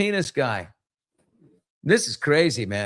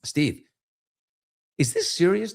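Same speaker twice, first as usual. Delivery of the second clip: ordinary talk, nothing marked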